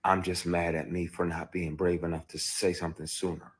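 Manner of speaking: ordinary talk, nothing marked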